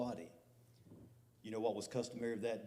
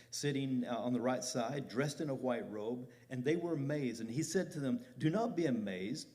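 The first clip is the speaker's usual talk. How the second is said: forceful scripture reading; 3 semitones higher, 4 dB louder than usual. Acted. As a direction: preach the verses out loud and ringing. Reads scripture aloud with force.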